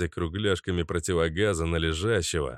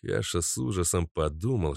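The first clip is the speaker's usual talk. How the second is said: usual speaking voice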